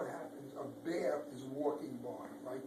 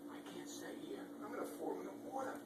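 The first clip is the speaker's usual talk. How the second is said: whisper; 5 semitones higher, 11 dB quieter, faster than usual. Acted. Ladybug frightened.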